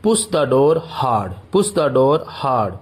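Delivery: each word slow and separate for learners; slow dictation pace. Shown as a demonstration. Teacher to Hindi students, model sentence said very slowly. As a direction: spell it out slowly so students can repeat. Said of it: "तो इसे आप बोलेंगे".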